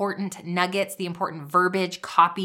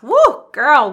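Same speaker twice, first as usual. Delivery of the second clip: big high whoop of joy; excited cheer; 6 semitones higher, 10 dB louder than usual. Real, not acted. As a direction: cheer her on at full voice. Cheering a listener's success.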